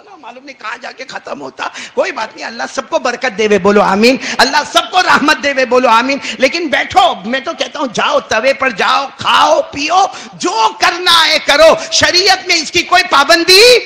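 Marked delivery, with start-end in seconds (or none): 1.9-13.8 high, loud rally; fiery preaching. Acted. Preacher rallies congregation for amen, fiery preaching. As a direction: rouse the crowd to say amen.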